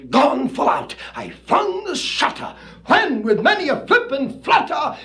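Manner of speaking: high, hammed-up beatnik recitation with shouted peaks; jazzy poem recital. Reciting the hip Raven to the crowd, jazzy shouted recital. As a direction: swing the verse like a hopped-up jive poet.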